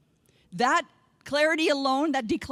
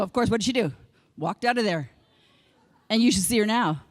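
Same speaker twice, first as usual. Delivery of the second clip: usual speaking voice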